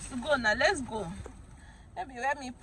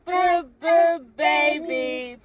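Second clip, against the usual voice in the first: louder sounding out; 3 semitones higher, 5 dB louder, much slower than usual. Shown as a demonstration. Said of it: "sounding out again".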